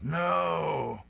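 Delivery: high, drawn-out mock yell. A narrator's reading. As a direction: cry it out in hammed-up protest. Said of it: "Luke turns to him and yells".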